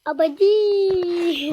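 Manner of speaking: Abadim stretched out slowly; slow drawl. Shown as a demonstration. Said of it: "Abadim ne?".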